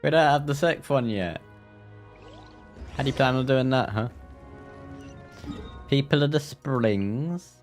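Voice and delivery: ordinary talk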